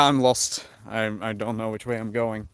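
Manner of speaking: ordinary talk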